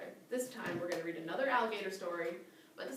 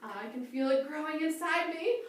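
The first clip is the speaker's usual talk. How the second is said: a touch higher, slower worry; lightly voiced worried crocodile; 7 semitones higher, slower than usual.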